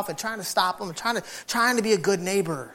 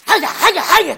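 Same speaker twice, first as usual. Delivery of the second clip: high, louder yapping bites; dog attacking noise; 11 semitones higher, 10 dB louder, slower than usual. Retold or imitated. Imitating the dog biting the fence wood. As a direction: yap at the wood like a frantic dog. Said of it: "It attacks the wood".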